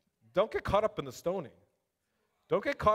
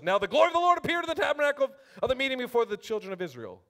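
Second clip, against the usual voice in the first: raised, ringing Bible reading to the room; scripture reading; 7 semitones higher, 5 dB louder than usual. Acted. Preacher reading the spies' report aloud to the church, proclaiming. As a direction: proclaim the verse to the congregation.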